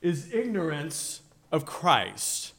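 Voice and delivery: loud, high preaching with deliberate pauses; emphatic preaching. Real, not acted. Preacher urging listeners to read the Scriptures, emphatic preaching. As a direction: preach it slowly and forcefully, phrase by phrase.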